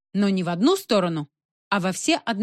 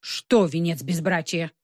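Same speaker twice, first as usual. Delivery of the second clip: ordinary delivery, nothing marked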